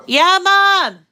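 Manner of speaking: loud, high call across the house; calling out to Mom. Real, not acted. Answering her mother's call from another room, loud and high. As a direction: shout back so Mom hears you from far off.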